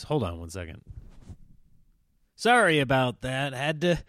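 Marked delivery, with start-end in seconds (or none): none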